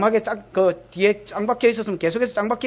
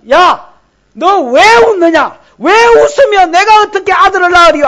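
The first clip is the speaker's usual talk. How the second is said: loud, high scolding shout; thundering mock-divine reprimand; angry rebuke; 8 semitones higher, 10 dB louder than usual. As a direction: confront her forcefully over the laugh. God voiced scolding Sarah for laughing.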